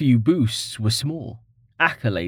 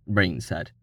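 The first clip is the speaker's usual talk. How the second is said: usual speaking voice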